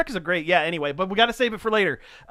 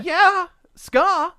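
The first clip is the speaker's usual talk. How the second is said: excited; 12 semitones higher, 6 dB louder than usual. Real, not acted. Excited about ska.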